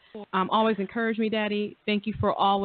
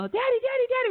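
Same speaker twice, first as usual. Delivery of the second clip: put-on excited kids calling. Kids greeting father, retold.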